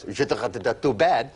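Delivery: slow mock French gibberish; fake French. Comic mimicking the customs guy, slow mock French. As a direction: garble it like a customs officer. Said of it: "the guy goes".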